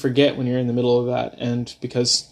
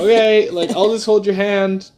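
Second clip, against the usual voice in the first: high, airy and carefree; lightly mocking his own laid-back self; breezy chill partner; 8 semitones higher, 4 dB louder than usual. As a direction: play it totally relaxed, no worries. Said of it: "I was just chilling".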